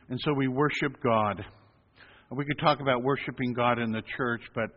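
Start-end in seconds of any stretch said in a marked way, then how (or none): none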